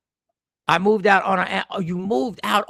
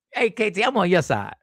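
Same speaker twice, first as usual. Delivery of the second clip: normal talking voice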